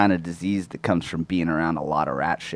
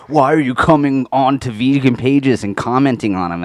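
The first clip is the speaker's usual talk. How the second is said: indignant vegan; 7 semitones higher, 7 dB louder than usual. Retold or imitated. Mocking vegans' complaint.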